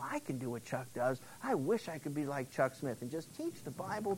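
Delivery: soft, close, confiding preacher voice; intimate sermon aside. Acted. Preacher confiding softly to the congregation about Chuck Smith. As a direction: lean in and confide quietly.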